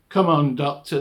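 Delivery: loud, coaxing call; goading guard. Retold or imitated. Guards pressing the doctor to join in, loud goading.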